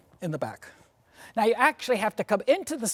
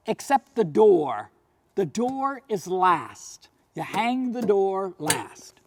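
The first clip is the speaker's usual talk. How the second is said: slow emphatic; slower than usual. Shown as a demonstration. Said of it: "slowed for emphasis".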